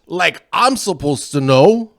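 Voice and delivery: loud, high, slow Scots-Irish brogue; slow, loud stage Irish-Scots voice; hammed-up Scottish-Irish accent. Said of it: "I always wanna be good at accents".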